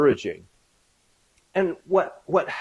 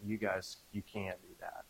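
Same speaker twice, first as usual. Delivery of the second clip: ordinary talk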